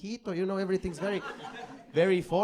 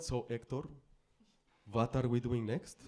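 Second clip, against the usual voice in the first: low, quiet, ominous Polish woman; sinister Polish date; 7 semitones lower, 9 dB quieter than usual. Retold or imitated. Chatty Polish date asks him what's next, low and sinister. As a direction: sound like you're plotting a kidnapping. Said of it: "By the sound of that, it sounds like".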